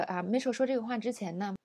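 ordinary talk

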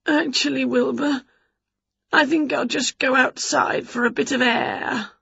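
high, uneasy witch excuse; strained, high, queasy politeness. A narrator's reading. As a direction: excuse yourself before you are sick. Winnie feels sick.

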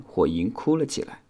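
ordinary delivery

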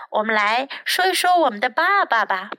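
plain reading voice

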